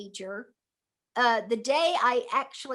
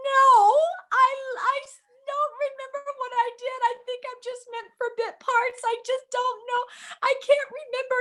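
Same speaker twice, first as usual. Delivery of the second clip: high, upset, near-crying; high anguished near-tears rambling; tearful despair; 12 semitones higher than usual. Retold or imitated. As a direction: plead with distress in every word. Telling agent she blew it.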